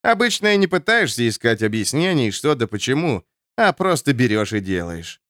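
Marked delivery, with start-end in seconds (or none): none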